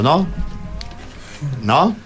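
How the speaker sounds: ordinary delivery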